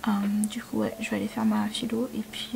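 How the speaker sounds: soft unvoiced whisper. Real, not acted. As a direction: hush it, barely voiced. Whispers plans.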